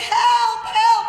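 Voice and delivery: high, loud mock yell for help; cry for help. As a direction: shout it like a kid stuck on a sheep. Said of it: "he was screaming".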